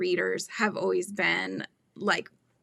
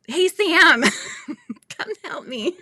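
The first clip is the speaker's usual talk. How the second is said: a loud, high call across the room; bright hail to a friend in another room; 8 semitones higher, 6 dB louder than usual. Real, not acted. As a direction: call her over from a distance. Calling out to someone nearby.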